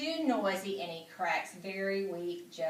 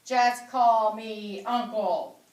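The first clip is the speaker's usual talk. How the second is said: slow, louder booming hearty pig; big hearty voice; 7 dB louder, slower than usual. Acted. The pig introducing himself to Charlotte, big and hearty. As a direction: boom it out like a jolly uncle. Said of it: "he said in a big, hearty voice".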